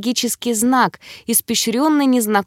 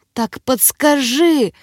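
slow, higher, strained demand; strained croak; 5 semitones higher, slower than usual. A narrator's reading. Girl writhing in pain demands a hint, croaking. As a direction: force it out drawn-out through pain. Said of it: "прохрипела я".

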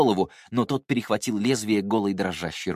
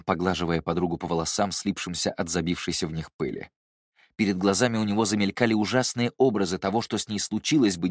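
ordinary delivery, nothing marked

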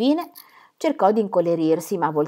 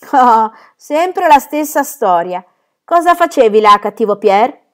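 louder, slow exasperated chiding; mock scolding; 5 semitones higher, 9 dB louder, slower than usual. Germain chides little Pierre, exasperated scolding. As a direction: scold the boy, fond but fed up.

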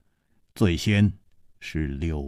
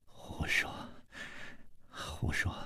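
barely voiced, very faint; whispered, weak disapproval; frowning rebuke; 17 dB quieter than usual. A narrator's reading. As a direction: scold feebly in a sick whisper. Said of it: "楚留香皱着眉说".

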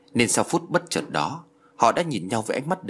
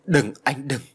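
higher-pitched, restrained cry of alarm; 4 semitones higher, slower than usual. A narrator's reading. Xuân Lan tries to stop Văn hurting himself, mildly crying out. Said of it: "đã la lên".

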